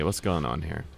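usual speaking voice

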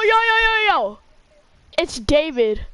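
ordinary talk

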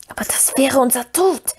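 high wailing creature plea; wailing Gollum. Acted. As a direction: wail it like a frightened creature. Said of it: "jammerte Gollum".

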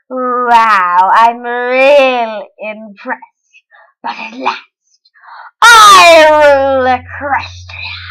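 gloating villain queen, loud and drawn out; mock-evil laughs and a triumphant, swelling boast; Queen Chrysalis. Villain queen conquering Equestria, gloating evil impression. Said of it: "That was my impression of her".

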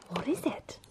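soft curious whisper. Real, not acted. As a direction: breathe it, barely voiced. Opening advent calendar, whispered.

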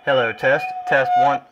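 loud, firm test call into the radio; mic check said loud. Real, not acted.

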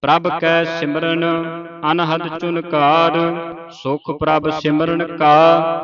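measured, level-toned Gurbani recitation; sustained monotone chant for learners to follow; scripture recitation. Shown as a demonstration. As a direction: recite evenly, holding the line endings.

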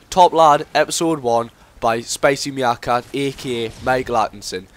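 loud, punchy title call; show title announcement. Acted. Vlogger announcing his new series title, loud and punchy. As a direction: announce the series name like a host.

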